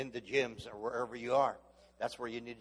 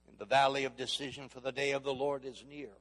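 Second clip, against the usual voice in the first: ringing preached verse, louder on valley; preaching scripture; 2 semitones higher, 5 dB louder than usual. Acted. Preacher reading Joel to the congregation, ringing. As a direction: proclaim the verse to the crowd.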